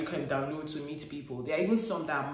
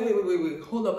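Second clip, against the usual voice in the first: loud burst cutting him off; retold alarmed interruption; 3 semitones higher, 7 dB louder than usual. Woman stopping her date over the hotel, retold alarmed interruption. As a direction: re-enact calling a sudden halt.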